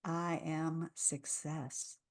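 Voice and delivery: very slow, soft, grounded; settled affirmation. Shown as a demonstration. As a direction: settle deep into the words. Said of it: "Let's really settle into it".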